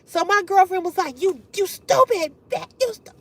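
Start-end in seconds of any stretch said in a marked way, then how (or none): 1.2-3.1 higher-pitched sputtering insults; mock furious tirade. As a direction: imitate the girlfriend losing it. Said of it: "she was going off".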